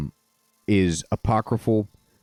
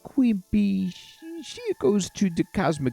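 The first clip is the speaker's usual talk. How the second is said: high, sing-song cartoon Italian accent; a much higher cartoon Italian voice explaining the lore; 8 semitones higher than usual. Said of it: "cartoon-sounding Italian accent".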